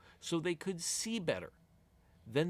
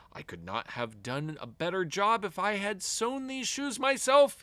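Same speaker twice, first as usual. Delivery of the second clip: amazed exclamation; 4 semitones higher than usual. Acted. Shoemaker exclaiming over shoes he didn't make, amazed. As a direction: marvel at the perfect stitching. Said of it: "he exclaimed".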